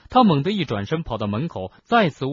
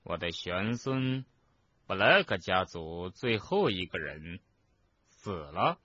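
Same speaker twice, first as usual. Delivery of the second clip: hushed, slow, halting question; 3 semitones lower, 6 dB quieter, slower than usual. A narrator's reading. Portrait learns his great-great-grandson is dead, slow and hushed. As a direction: let it out softly, piece by piece. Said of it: "慢慢地说".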